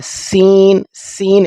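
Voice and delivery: سین with a sharp whistle-like س; whistled seen. Shown as a demonstration. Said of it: "سیٹی کی طرح ہوتا ہے". (سین سیٹی کی طرح)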